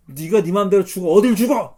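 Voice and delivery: loud, rising rebuke; indignant mock scolding; acted scolding. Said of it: "그러면서 막 꾸짖은 거예요".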